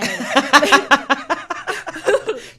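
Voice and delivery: big burst of laughter; loud laugh. Real, not acted. Laughs at her voice.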